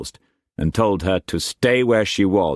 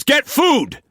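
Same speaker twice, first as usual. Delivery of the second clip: higher, louder raging master's voice; furious master; 4 dB louder than usual. A narrator's reading. Struan raging at Mei Mei before whipping her, furious shout. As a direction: bark orders like an enraged lord.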